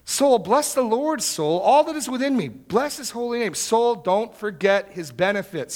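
high, loud exhortation to oneself; emphatic, passionate preaching. Shown as a demonstration. Preacher acting out David preaching to his soul, passionately emphatic. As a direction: order your soul to praise, urgently. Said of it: "this is emphatic, this is passionate".

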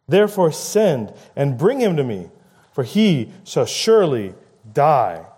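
normal talking voice

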